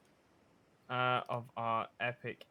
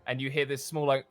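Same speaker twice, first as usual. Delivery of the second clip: game master painting a chase scene; dramatic scene narration; 4 semitones higher, 11 dB louder than usual. Acted. Game master setting the scene for players, cinematic narration. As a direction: narrate it like a movie trailer.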